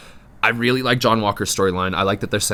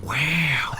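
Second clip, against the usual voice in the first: whispered wow; 4 semitones higher, 4 dB quieter than usual. Acted. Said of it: "must do a wow for moments".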